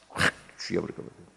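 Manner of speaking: whispered aside; conspiratorial whispered threat; whispered joke. Acted. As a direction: hush it like a naughty secret. Joking aside about nagging wife.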